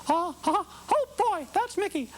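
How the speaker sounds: high falsetto Mickey, just a bit off; a near miss at the Mickey squeak; slightly off Mickey. Shown as a demonstration. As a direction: demo of a Mickey voice not quite right. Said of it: "if you don't hit Mickey just right".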